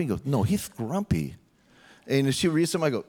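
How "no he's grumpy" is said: slow, lightly voiced small girl; a hint of a stubborn little girl. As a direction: lightly imitate a young child insisting. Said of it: "when she was really young, she goes".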